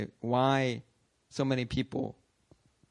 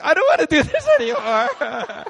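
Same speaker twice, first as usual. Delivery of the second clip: very high, loud, laughing whine; whiny new leader; 10 dB louder than usual. Retold or imitated. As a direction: whine it like a scared child. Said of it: "the new leader was like".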